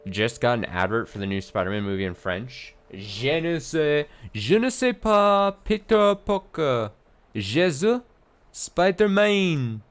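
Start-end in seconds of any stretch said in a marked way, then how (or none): 2.9-6.9 high, slow hammed-up French accent; mock French accent. Streamer mocking a French Spider-Man advert in a silly French accent. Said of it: "That was my best French". 7.3-9.8 high, slow, hammed-up French "Spider-Man"; mock French accent. Reading a chat message aloud in a mock French accent. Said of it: "That was my best French".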